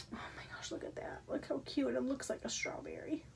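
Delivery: soft, hushed cooing over something cute; breathy, quiet delight; awed whisper. Real, not acted. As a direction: whisper it, full of wonder. Marvels at the strawberry candle.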